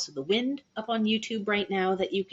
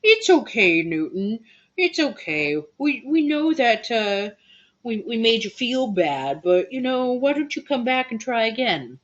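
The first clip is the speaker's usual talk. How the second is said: coddle a sulker with high, louder, soothing coos; babying; 6 semitones higher, 5 dB louder than usual. Acted. Band member coaxing Newton back after hurt feelings, babying. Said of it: "had to be babied a little bit".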